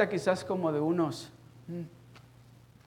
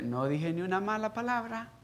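usual speaking voice

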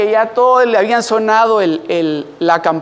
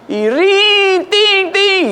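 sung-out bell ring, much higher; imitated school bell; 10 semitones higher, much slower than usual. Child hearing the bell that ends class, sung high.

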